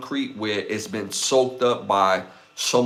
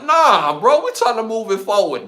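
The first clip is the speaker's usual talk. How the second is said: high, loud dismissive comeback; acted protest, high and emphatic; mock indignant retort; 11 semitones higher, 5 dB louder than usual. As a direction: shoot down the excuse, voice pitched way up. Acted retort to the athlete excuse.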